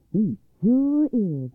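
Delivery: emphatic, delighted storybook mother; triumphant confirmation. A narrator's reading. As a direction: stretch it out in victory. Mother triumphantly reassures her son.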